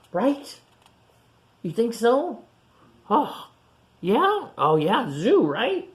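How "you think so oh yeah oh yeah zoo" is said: ordinary delivery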